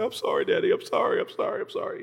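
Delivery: high, soft, whispery pleading; imitated terrified child. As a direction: mimic a scared little boy apologizing over and over. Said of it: "he was so terrified".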